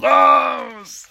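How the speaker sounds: loud, long roared order; villain losing his temper, shouting; furious shout. A narrator's reading. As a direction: bellow it to throw the henchman out. Villain throws his henchman out.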